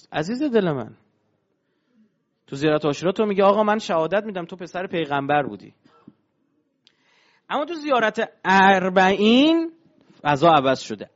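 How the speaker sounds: ordinary talk, nothing marked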